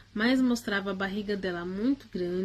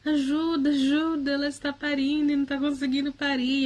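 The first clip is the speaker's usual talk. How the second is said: higher-pitched, urgent plea for help; mock shout for help, pitched up; retold cry for help; 5 semitones higher, 3 dB louder than usual. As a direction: re-enact calling out for help, voice lifted but not a full scream. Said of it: "Eu gritava e falava".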